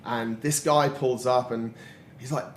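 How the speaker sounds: normal talking voice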